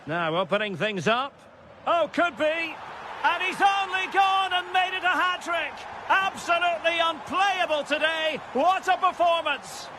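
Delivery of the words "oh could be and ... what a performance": high, ringing commentary cry; excited goal call. Real, not acted. Commentator celebrating a hat-trick, excited shout. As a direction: hail the scorer with soaring excitement.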